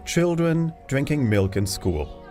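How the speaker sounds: ordinary talk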